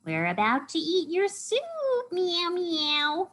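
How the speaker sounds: high meowing cat voice; high storybook cat; cat character. A narrator's reading. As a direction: call it out high, like a teasing cat.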